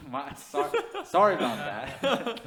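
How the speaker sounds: laughing through a sheepish sorry; laughing apology. Real, not acted. Host laughing off his name slip, laughing. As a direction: giggle out the apology.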